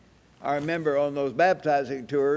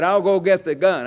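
higher, louder imitation of a threat; retold threat; 4 semitones higher, 7 dB louder than usual. Angry farmer threatening the visitors, retold loud threat. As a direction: throw it out like a riled farmer.

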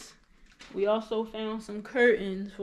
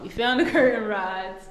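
laughing; 7 dB louder than usual. Real, not acted. Giddy over found curtain rods.

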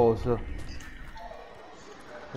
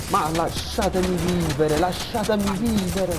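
exasperated wail; 6 semitones higher than usual. Real, not acted. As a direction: beg dramatically to be let alone. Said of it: "lui lo dice, lasciatemi vivere".

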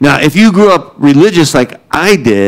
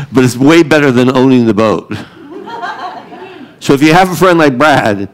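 normal talking voice

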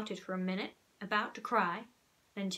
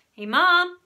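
louder, higher call across the house; raised call to Mom; 8 semitones higher, 12 dB louder than usual. Acted. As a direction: call Mom from the other room. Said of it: "I called".

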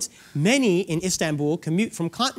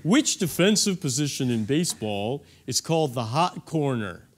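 lower, jock-style quizmaster; 7 semitones lower than usual. Pretend. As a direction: read it like a laid-back jock. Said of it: "say it in more of a jock voice".

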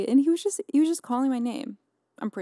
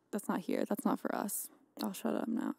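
softer, trailing self-aware aside; quiet aside; 8 dB quieter than usual. Real, not acted. Vlogger cutting her own rambling short, quiet aside. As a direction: drop the voice as if catching herself rambling.

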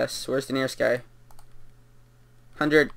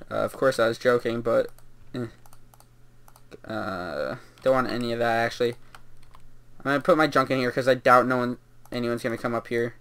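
normal talking voice

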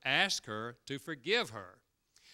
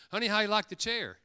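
wife's eager question; 8 semitones higher, 5 dB louder than usual. Retold or imitated. Wife voiced asking.

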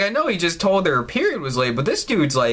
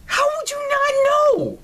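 very high-pitched disbelieving squeal; incredulous screech. Real, not acted. Player reacting to not knowing George from Sam, incredulous screech.

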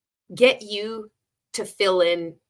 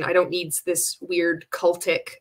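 ordinary talk

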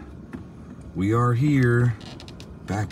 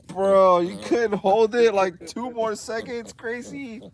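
higher, half-laughing complaint at the puppy; exasperated; 12 semitones higher, slower than usual. Real, not acted. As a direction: scold the puppy with mock disbelief. Puppy just peed on her.

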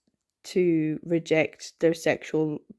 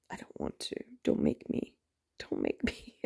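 whiny whisper; 12 dB quieter than usual. Real, not acted. Reviewer refusing to read volume three, whispered whine. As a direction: whisper it, pleading not to be made to.

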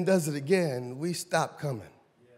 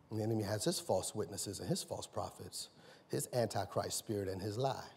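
much lower, much softer, earnest; hushed teaching; 10 semitones lower, 10 dB quieter than usual. Real, not acted. Preacher quietly teaching the congregation, hushed and low. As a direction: bring it down to a near murmur.

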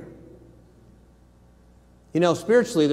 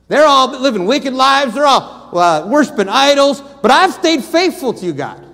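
loud, high, impassioned preaching; rising loud sermon cry; preaching fire; 9 semitones higher, 9 dB louder than usual. Acted. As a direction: thunder it out to the congregation. Preaching at full force.

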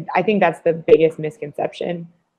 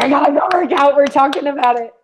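higher, louder mock-impatient whine; impatient Adam; 9 semitones higher, 4 dB louder than usual. Teasing a friend who wants to go work out, mock impatience. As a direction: tease him by aping his restlessness. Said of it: "Adam's like".

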